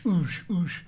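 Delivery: normal talking voice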